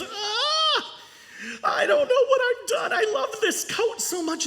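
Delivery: hammed-up sob for the kids; boy wailing about his torn favourite coat; crying in despair. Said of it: "And so he cried. He was so angry".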